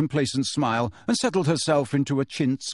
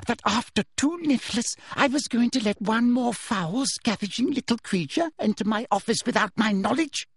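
higher, sugary menacing voice; Umbridge whispering menace; 8 semitones higher than usual. Acted. Umbridge menacing Harry, high sweet threat. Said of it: "she whispered".

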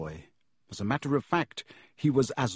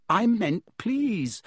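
quickly; 9 semitones higher, much slower than usual. A narrator's reading. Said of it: "said Harry quickly".

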